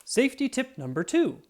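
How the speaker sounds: high, loud announcement; announcing a tip. Acted. Officer announcing his next tip to the children, loud and high.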